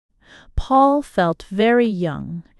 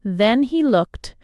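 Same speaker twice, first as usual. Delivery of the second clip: ordinary delivery, nothing marked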